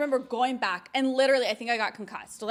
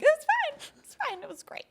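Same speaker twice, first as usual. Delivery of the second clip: forced cheer. Retold or imitated. Faking fine after the cane.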